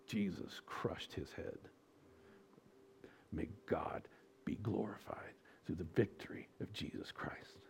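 hushed reverent prayer; whispered prayer. Real, not acted. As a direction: breathe it out in awe.

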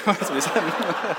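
laughing. Real, not acted. Laughing after the punchline.

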